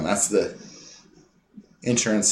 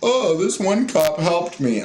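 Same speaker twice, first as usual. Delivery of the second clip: higher, slow, mocking tone; mock naive quote; 6 semitones higher, slower than usual. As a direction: parody a gullible defender of police.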